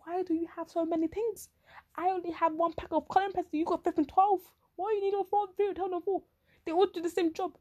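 high-pitched, exasperated questioning; mock exasperation. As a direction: act out a baffled complaint, voice up high. Acts out questioning overstocked classmate.

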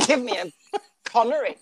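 ordinary delivery

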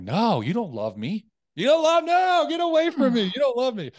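loud, high-pitched drunken wail, acted out; belligerent. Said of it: "like, belligerent".

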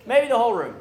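loud, punched-up observation. Real, not acted. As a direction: raise the volume to the whole room.

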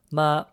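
ma high and level; first tone. Shown as a demonstration. Co-host explaining the character for mom, first tone. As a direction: hold ma on a steady high tone. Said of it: "in the first tone".

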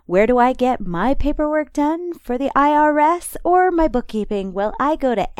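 upbeat radio ad voice; ad read. Acted. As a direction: read it like a sponsor spot. Sponsor ad read.

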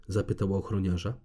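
usual speaking voice

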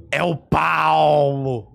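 "É o Paulo" arrastado bem devagar, voz de personagem. Shown as a demonstration. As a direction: demonstra a voz do Paulo pro sobrinho, bem lenta. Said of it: "Você consegue fazer assim, ó?". (tio mostrando ao sobrinho a voz do Paulo, bem arrastada)

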